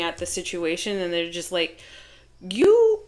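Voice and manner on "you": squeaky high mock "You"; mock high squeal. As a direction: screech it like an offended lover. Mocking the upset Virgo.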